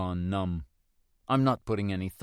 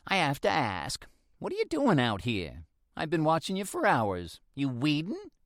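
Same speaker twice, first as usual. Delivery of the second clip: put-on curious old woman; 6 semitones higher than usual. A narrator's reading. Mildred asks, voiced.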